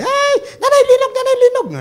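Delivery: high, loud frightened shouting; mock panic yelling for mom; panicked cry. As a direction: scream for mother in terror. Panicked people during earthquake.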